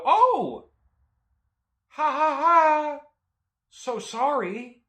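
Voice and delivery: high, loud fake laugh, slow apology; awkward forced laughter. Buyer reacting to driver's overshare, awkwardly forced. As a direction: overreact shrilly, then drag out the sorry.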